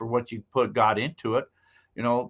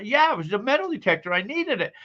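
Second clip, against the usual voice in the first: higher, fast mock excuse; sheepish husband; 8 semitones higher than usual.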